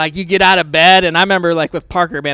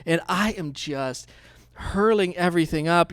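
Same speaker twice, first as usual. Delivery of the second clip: ordinary talk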